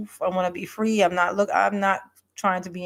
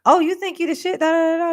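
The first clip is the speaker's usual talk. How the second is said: sassy mock quote; 8 semitones higher, 6 dB louder than usual. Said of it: "if she says".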